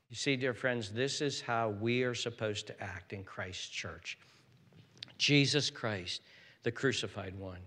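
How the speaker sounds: usual speaking voice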